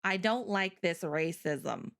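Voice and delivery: slow, low deadpan imitation; mock annoyed. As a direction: grumble it slowly, low and deadpan. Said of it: "Grace out here being like".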